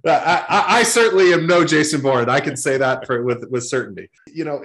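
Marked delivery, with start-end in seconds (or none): 0.1-2.3 laughing it off, embarrassed. Real, not acted. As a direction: laugh through the denial. Jason Bourne movie joke.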